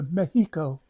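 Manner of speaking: "Mexico" said Spanish-style as "MEH-hee-ko"; would-be native pronunciation. Retold or imitated. Mocking tourists who try to sound native, "Mexico" as "meh-HEE-ko". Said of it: "try to sound like all, you know, native".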